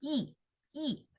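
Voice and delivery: 蚁 quiet, dropping from high; soft falling 蚁, fourth tone. Shown as a demonstration. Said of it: "It's falling tone".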